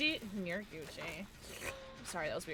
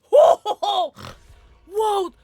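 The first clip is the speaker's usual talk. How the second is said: loud startled whoa; surprised shout; 9 semitones higher, 16 dB louder than usual. Real, not acted. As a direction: yell whoa at the screen. Game surprise.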